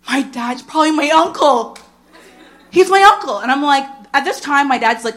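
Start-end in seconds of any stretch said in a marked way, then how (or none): 0.1-3.4 panicked realization. Retold or imitated. Panicked conclusion about family.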